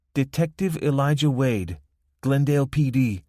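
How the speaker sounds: lightly voiced Spanish accent. A narrator's reading. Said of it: "she read".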